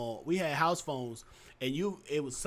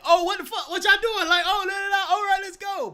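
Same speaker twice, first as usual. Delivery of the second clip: high, louder excited yelling; 13 semitones higher, 6 dB louder than usual. Shown as a demonstration. Showing how his voice goes when excited, high and loud. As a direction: get hyped and loud. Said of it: "my voice gets higher".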